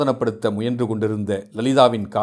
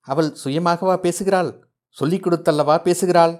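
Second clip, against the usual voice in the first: accusing; 7 semitones higher, faster than usual. A narrator's reading. Mother accuses someone of coaching daughter.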